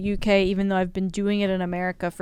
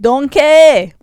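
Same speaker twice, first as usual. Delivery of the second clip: put-on loud Scottish accent, like Shrek; Shrek's Scottish accent; 6 semitones higher, 13 dB louder than usual. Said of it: "Can you guys do Scottish accents?".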